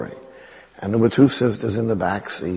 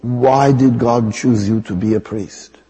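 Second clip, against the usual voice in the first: imitated nun's accent, slow pointed question; Mother Teresa; 4 dB louder than usual. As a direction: put on her accent and ask slowly, firmly. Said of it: "I have to use the accent".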